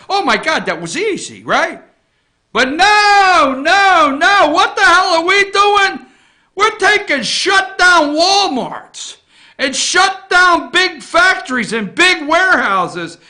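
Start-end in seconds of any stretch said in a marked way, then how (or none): 2.5-10.7 loud, high-pitched indignant protest; exasperated outburst. Real, not acted. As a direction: cry out in real exasperation. Exasperated rant about the government.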